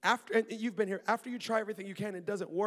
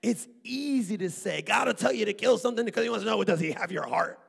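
loud pulpit fire, rushing ahead; mock the easy sermon with full force; hammed-up sermon soundbite; preaching at full force; 3 semitones higher, 10 dB louder than usual. Mocking an easy sermon line.

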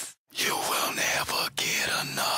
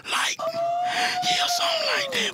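shouted; 4 dB louder, slower than usual. Acted. Shouted ad-lib.

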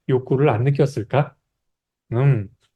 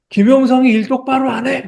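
much higher-pitched mock bellow; imitated angry scolding; 9 semitones higher than usual. Elderly company head scolding his employee, imitated angry outburst. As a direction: bawl out an employee like a cross old boss. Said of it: "막 호통을 치시는 거예요".

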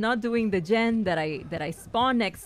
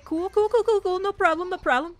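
high, louder string of quick repeats; flustered reassurance; 9 semitones higher, 5 dB louder than usual. Real, not acted. Player covers a slip in the game, flustered. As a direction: rattle it off as if all is fine.

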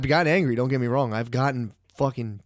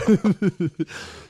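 high laugh; 7 semitones higher, 4 dB louder than usual. Real, not acted. Comic laughing at his own joke with a friend.

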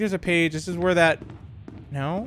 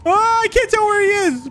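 panicked; 13 semitones higher than usual. Real, not acted. Chased by monster in horror game.

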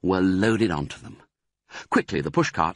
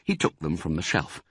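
plain reading voice

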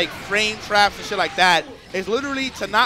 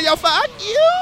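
mock character line; 12 semitones higher, 3 dB louder, slower than usual. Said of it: "Yo, she said".

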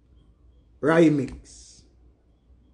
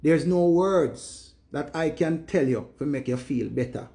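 usual speaking voice

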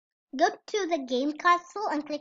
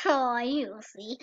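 ordinary talk, nothing marked

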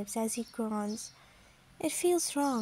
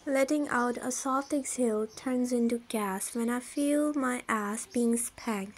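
usual speaking voice

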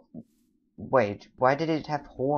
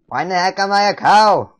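high, loud, incredulous yell; dismayed shout; 8 semitones higher, 13 dB louder than usual. Pretend. Player still baffled at being a cow, dismayed shout. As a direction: yell it in mock outrage.

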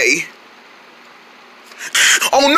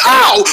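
pained yelp; 11 semitones higher, 5 dB louder than usual. Pretend. Hand hurt punching toy.

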